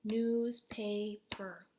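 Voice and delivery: "Newspaper" drawn out very slowly as news-pa-per; slow classroom syllable reading; counting syllables. Shown as a demonstration. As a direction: stretch the word so kids can count the beats. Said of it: "Let's count the syllables".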